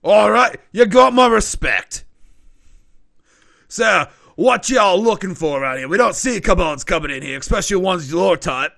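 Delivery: grudging respect, said loud; impressed barkeep. Acted. Barkeep to the intimidating kobold, loud grudging respect. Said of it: "he's like".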